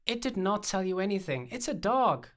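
exasperated, high-pitched correction; explaining to a toddler. A narrator's reading. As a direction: spell it out as if to a small child. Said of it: "like I was explaining this to a toddler".

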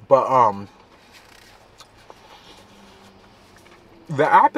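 chewing hot food; talking while eating; mouth full. Real, not acted. Seen 2: Holds burrito, mouth open airing food, takes new bite mid-phrase.

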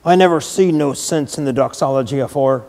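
put-on hoarse, moved old gardener; hoarse old man. A narrator's reading. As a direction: rasp it out, choked up. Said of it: "he said hoarsely".

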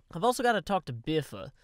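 ordinary delivery

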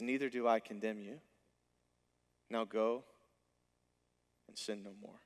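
tender. Acted. Jesus forgives the woman.

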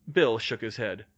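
ordinary delivery, nothing marked

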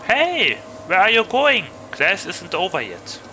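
loud, high call after a student; teacher calling out. Acted.

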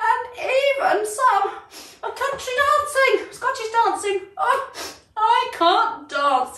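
high, slow, half-crying giraffe voice; a lonely giraffe whimpering through his complaint; sad Gerald. Acted. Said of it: "And Gerald said".

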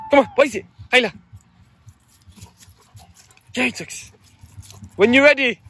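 loud, high excited calls; excited urging. Real, not acted. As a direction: hype the dog up to play. Urging dog to play.

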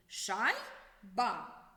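Шай-ба said in two clear beats; split into syllables. Shown as a demonstration. Teacher showing pupils the two syllables, Шай-ба. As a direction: pause between Шай and ба. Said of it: "ми вимовляли слова частинками".

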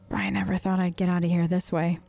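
ordinary talk

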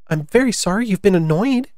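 a hint of a high child voice; lightly voiced, apologetic storybook girl. Alice apologises to the pigeon.

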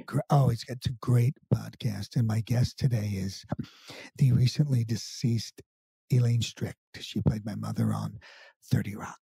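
low hushed podcast-host voice; Alec Baldwin impression. Impersonator stays in his Baldwin podcast voice. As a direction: keep it low and intimate like a radio host. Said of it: "as him".

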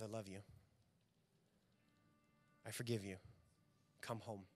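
Jesus calling the lost one home, softly; tender forgiveness. Retold or imitated.

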